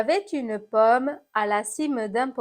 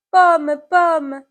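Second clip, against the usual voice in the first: loud, higher call to the apple; 6 semitones higher, 9 dB louder than usual. Acted. Someone calls to the fallen apple, calling out. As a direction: call out with alarm, pitch raised.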